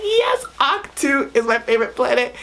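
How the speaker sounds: high, thrilled squeal of approval; excited reaction. Retold or imitated. Fan retelling her reaction to seeing Ahch-To return, excited. Acting out own delighted reaction.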